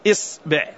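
إصبع opening on a short i, "is-"; kasra on hamza. Shown as a demonstration. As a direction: say إصبع split, starting "is-". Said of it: "وأتي بها مكسورة".

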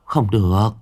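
mother frantically forbidding her son to quit; alarmed mother. A narrator's reading. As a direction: refuse firmly and climb in pitch. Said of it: "giật mình, lắc đầu".

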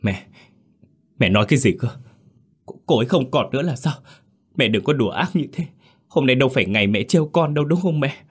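trembling, high, fast disbelief; giọng run rẩy. A narrator's reading. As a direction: plead in shock, voice shaking. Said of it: "giọng run rẩy như không phải của mình". (run rẩy, không dám tin)

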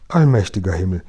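a queen's startled exclamation; exclaiming. A narrator's reading. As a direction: cry it out in alarm. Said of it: "rief die königliche Stimme".

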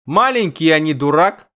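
high and loud, teasing; excited quip. Acted. Dandelion jokes about the dragon, loud and gleeful.

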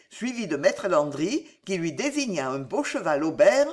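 ordinary delivery

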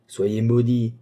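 loud, solemn curse; cursing. A narrator's reading. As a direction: hurl the curse as he walks away. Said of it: "répétait-il en s'éloignant lentement". (le moine répète sa malédiction en s'éloignant, fort)

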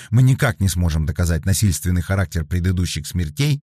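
ordinary delivery, nothing marked

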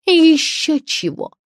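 high, loud, drawn-out scoff; squawked retort; defiant refusal. A narrator's reading. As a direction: refuse indignantly. Writer defiantly refuses to apologise.

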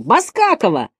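high-pitched mocking classroom calls; drawn-out, high schoolkid heckling; pupils shouting. A narrator's reading. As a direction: yell out names from the desks, high. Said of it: "закричали Слушкину".

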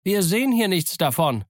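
high holler from above; called answer. A narrator's reading. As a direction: call back down from an upstairs window. Man at window calls down.

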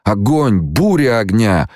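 high, drawn-out moan of horror. A narrator's reading. Wounded man led past Paul, moaning. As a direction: moan it, reliving the fire. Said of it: "стонал он".

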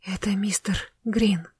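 slow breathy whisper of shock. A narrator's reading. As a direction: whisper it, stunned. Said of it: "зашелестела Варя".

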